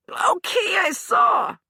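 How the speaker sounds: high, loud yelping admission. Acted. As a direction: yelp it out in pain. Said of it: "he yelped".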